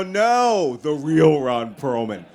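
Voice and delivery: loud, high insistent no; a loud no, then laughing; insistent correction. Retold or imitated. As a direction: correct them, firmly and loudly. Correcting the staff.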